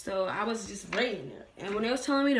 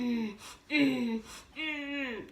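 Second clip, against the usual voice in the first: higher-pitched closed-mouth straining hums; pushing grunts; 5 semitones higher, much slower than usual. Retold or imitated. Her pushing through contractions, mock straining. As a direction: imitate pushing with repeated "mm" grunts. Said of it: "I just kept pushing".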